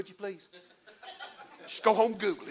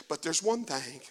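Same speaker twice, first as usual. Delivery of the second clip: hushed aside; 8 semitones lower, 9 dB quieter than usual. Acted. Preacher drops voice for key point.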